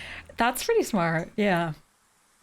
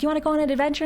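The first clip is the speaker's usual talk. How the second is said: put-on higher, bouncy sing-song question to a little kid; higher, eager mom voice coaxing her toddler; playful invitation to toddler; 7 semitones higher, much faster than usual. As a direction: make the adventure sound thrilling.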